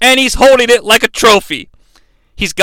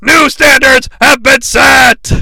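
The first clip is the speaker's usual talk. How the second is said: slow, high announcer proclamation; wrestling announcer; 5 semitones higher, 4 dB louder, slower than usual. Retold or imitated. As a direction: proclaim the correction like a ringside announcer. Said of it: "He then corrects himself".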